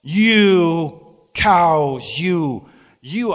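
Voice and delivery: a high, loud hellfire yell at cattle; hammed-up fire-and-brimstone shout; mock preacher shout. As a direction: bellow it at the herd like a street preacher. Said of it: "I went".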